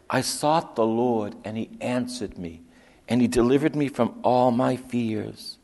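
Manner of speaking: loud, driving psalm proclamation; fervent scripture reading. Acted. Preacher proclaiming the psalm to the congregation, loud and fervent.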